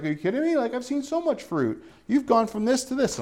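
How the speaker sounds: lightly imitating a friend's amazed reply; friend's disbelief. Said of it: "My friend's like".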